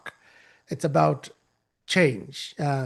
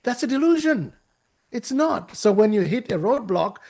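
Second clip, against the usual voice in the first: usual speaking voice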